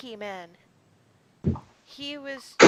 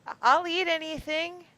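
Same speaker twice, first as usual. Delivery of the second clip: higher, louder singy man voice; 7 semitones higher, 9 dB louder than usual. Pretend. Singy man politely accepting a dinner invitation, higher and louder. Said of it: "the singy man said".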